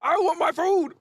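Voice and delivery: high, put-on monster voice; a spoiled beast demanding snacks; greedy devil demanding food. Devil appears on the street demanding snacks, high greedy voice. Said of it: "He said".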